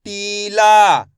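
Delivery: टीला very slow, both vowels held; stretched pronunciation. Shown as a demonstration. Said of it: "मैं इसका खींच के उच्चारण कर रहा हूँ".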